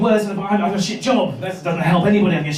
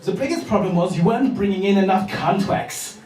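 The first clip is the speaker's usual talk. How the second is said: mock German sales manager; comic German-accented business English. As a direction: address the meeting with a German accent. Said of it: "a sales meeting and yet you're working for a German".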